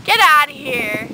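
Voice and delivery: real startled shoo; shooing away. Real, not acted. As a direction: chase it off sharply. Shooing something away mid-sentence.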